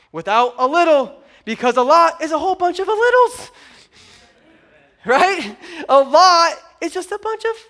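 high, loud call-and-response preaching; playful, rising preacher's shout; rallying the crowd. Real, not acted. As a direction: climb in pitch and get everyone to join in. Preacher rallies crowd to answer.

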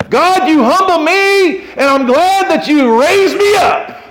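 high, loud exultant cry; full-voiced, fervent declaration to God; shouted praise. Shown as a demonstration. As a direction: shout thanks to heaven, drawing words out. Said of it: "if you say it like that".

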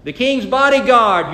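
mock shout down from the hillside; preacher hollering across a valley. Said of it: "David shouts down".

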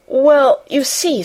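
a flustered start, caught off guard. Acted. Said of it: "Missus Medlock really was flushed".

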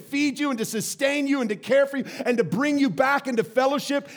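loud, high, mounting indignation; impassioned preaching as God. Acted. God rebuking those who deny sin, indignant preaching. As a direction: build a driving list to a shouted accusation.

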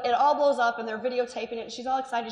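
ordinary talk

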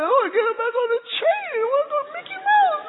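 put-on very high, fast child's wail; mock tearful child. Boys on verge of tears, hammed-up wail. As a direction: imitate a kid bawling in protest. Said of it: "two children on the verge of tears".

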